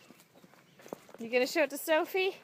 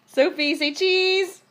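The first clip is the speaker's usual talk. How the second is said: coaxing; 6 semitones higher, 6 dB louder than usual. Real, not acted. Coaxing a photo smile.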